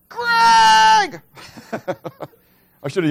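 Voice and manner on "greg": high, loud shout across the woods; yelling a name. Retold or imitated. Lost hunter calling his friend in the dark woods, loud shout. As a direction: call out for help, full voice. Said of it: "And I yelled out".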